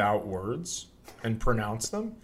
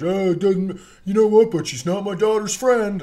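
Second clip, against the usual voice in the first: mimicking a defensive guy; 8 semitones higher, 6 dB louder than usual. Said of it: "I was kinda like".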